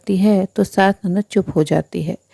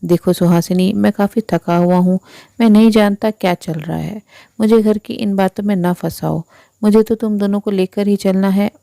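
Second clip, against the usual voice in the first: usual speaking voice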